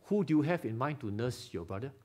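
usual speaking voice

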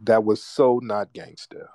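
normal talking voice

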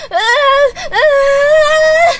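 high, loud tearful wail; overwhelmed scream. Real, not acted. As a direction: scream wordlessly with emotion, rising. Overwhelmed reaction to the trailer. (chillido agudo, desbordado de emoción)

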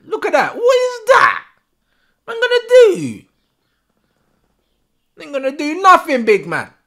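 mocking shouted taunt. Real, not acted. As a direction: jeer at the striker from the sofa. Mocking player's play.

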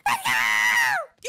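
high, yelled gamer cheer; excited shout. Acted. Brother absorbed in his game, high excited shout. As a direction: yell it in triumph.

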